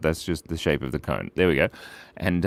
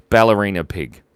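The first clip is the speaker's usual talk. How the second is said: louder, punchy announcement of the winner; announcing; 8 dB louder than usual. Real, not acted. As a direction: call out the winner. Reading out the winning poll choice.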